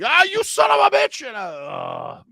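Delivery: very high, loud mock outburst fading into grumbles; angry chef yelling. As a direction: blow up as the furious old chef, then tail off. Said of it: "angry, yell, rah, rah, rah".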